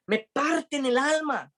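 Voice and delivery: high and slow, aching; heartbroken. Retold or imitated. Pablo telling pleading friends they break his heart, high and slow. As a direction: let each word break with grief, slowly.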